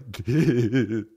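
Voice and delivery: goofy mock giggle; imitated laugh. Buddy laughing at a banana remark, imitated giggle. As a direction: giggle like the silly friend. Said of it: "And he'll be like".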